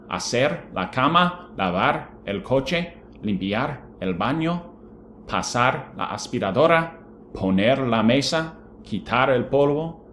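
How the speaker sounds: careful classroom pronunciation, each phrase rising at its end; Spanish vocabulary read-out. Shown as a demonstration. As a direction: model the Spanish words for the class. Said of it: "all the chores that we studied".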